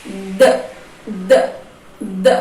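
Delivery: "D" as a short "duh" instead of its letter name; isolated d sound for each "D"; letter's phoneme. Shown as a demonstration. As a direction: sound out "D" in brief, separate bursts. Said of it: "representando o fonema".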